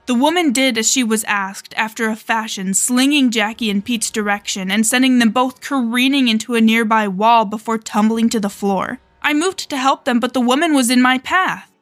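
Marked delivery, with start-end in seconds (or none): none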